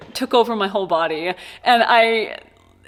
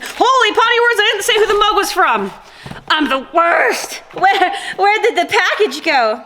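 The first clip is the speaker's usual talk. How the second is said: flustered excitement; 9 semitones higher, 4 dB louder than usual. Real, not acted. Forgot to name mug sender.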